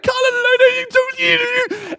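high, loud cheering squeal; excited cheering. Retold or imitated. Father cheering his son in the pool, high excited shout. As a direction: scream it out for your swimmer. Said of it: "I'm, like, cheering for Cullen".

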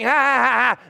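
loud mock laughter; imitated laughing. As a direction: act out people laughing at you. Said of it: "and they're laughing".

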